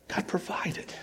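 breathy hushed whisper of wonder; whispered reverent aside; hushed awe. Acted. Awed aside in the sermon.

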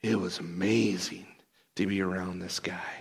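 low, soft wonder; hushed awe. Acted. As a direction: say it quietly, still marvelling. Awed reflection after rescue.

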